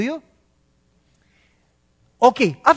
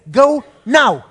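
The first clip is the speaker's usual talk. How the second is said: loud command; 4 semitones higher, 9 dB louder than usual. Acted. Jesus commanding Peter.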